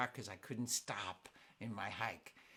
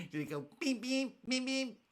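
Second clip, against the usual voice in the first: roadrunner beeps; 10 semitones higher than usual. Retold or imitated. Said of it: "A road runner".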